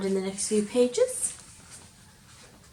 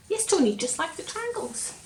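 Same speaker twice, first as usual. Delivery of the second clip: usual speaking voice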